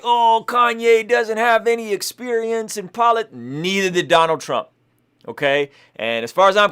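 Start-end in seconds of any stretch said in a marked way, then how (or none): 0.0-3.3 higher, whiny mock voice of the critics. Mimicking people who doubt [name], higher and mocking. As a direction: parody the naysayers, hammed-up. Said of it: "People are like".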